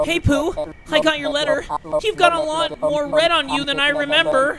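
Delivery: high, put-on Christopher Robin voice; wimpy kid. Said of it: "I made him sound like a little bitch".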